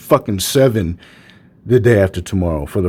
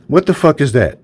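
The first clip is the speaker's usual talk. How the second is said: ordinary talk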